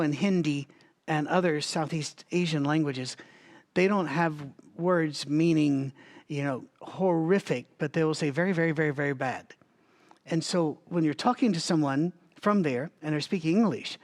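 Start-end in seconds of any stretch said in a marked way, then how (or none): none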